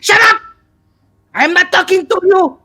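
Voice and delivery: high, loud mock outburst; snapping at himself. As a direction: shout down the voice in your head. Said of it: "starts speaking to himself".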